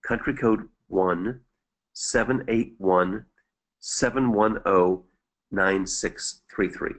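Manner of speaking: slow, spaced-out digit groups; slowly. Real, not acted. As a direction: read the number out slowly, pausing between groups. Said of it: "I'll repeat it slowly".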